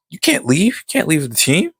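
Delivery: high-pitched mock disbelief; disbelieving protest. Rex told she's leaving, acted high disbelief.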